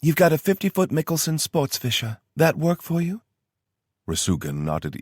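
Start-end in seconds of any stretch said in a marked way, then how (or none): 0.0-3.2 higher woman's voice with a Southern twang; brisk briefing in a Southern twang; a Southern-twanged logistics officer; Tara's Southern twang. Acted. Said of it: "the twang in her accent".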